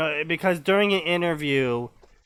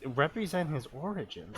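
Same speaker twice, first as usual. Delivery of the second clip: quieter, quicker puzzled repeat; baffled echo; 9 dB quieter than usual. Real, not acted. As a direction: query "represent his origins" under your voice. Repeats the line in disbelief.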